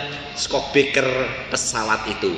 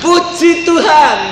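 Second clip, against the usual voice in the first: joyful praise; 9 semitones higher, 4 dB louder, slower than usual. Retold or imitated. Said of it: "yang sebelah bilang".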